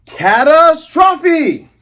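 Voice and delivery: very high, loud, drawn-out anime attack shout; stretched, high, loud finishing-move yell; yelled attack name. Retold or imitated. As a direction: hold the word out as a battle cry. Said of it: "and he yells".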